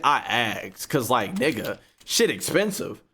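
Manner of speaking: normal talking voice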